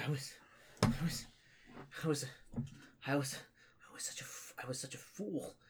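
halting, soft, whispery regret; broken, tearful acted confession; weeping confession. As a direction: stammer it out softly between long pauses.

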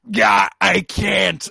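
loud, high, drawn-out mock cry; anguished shout. He refuses Jean's plea to kill her, anguished shout. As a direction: shout it slowly, hammed-up anguish.

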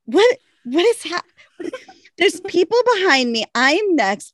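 high, laughing, frantic yelp; retold panic. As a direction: squeal like you're stuck at airport security. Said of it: "I'm like".

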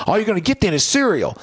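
playful dad threat; mock threat. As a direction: repeat the cereal threat, same menace. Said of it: "I said it like a threat".

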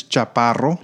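"Chaparro" in native Spanish, with a rolled double R; Spanish pronunciation. Shown as a demonstration. Guest showing host his last name, native Spanish pronunciation. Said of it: "in Spanish, it's".

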